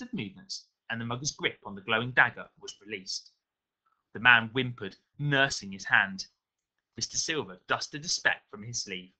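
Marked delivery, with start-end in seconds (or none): none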